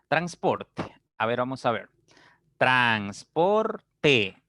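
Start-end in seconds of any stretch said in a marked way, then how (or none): 2.6-4.4 split into syllables. Shown as a demonstration. Teacher separates the word for students, "transporte" syllable by syllable. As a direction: sound out "transporte" in three drawn-out syllables. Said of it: "si yo separo esta palabra en".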